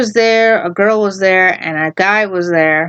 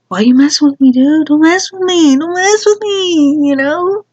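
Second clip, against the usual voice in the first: mock taunt; 7 semitones higher than usual. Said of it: "taunting imagined bully".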